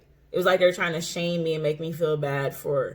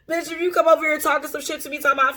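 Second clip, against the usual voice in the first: high, fast, heated challenge; fired up; 9 semitones higher, faster than usual. Real, not acted. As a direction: snap it out at the hater. Fired-up confrontational address.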